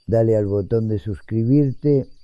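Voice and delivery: normal talking voice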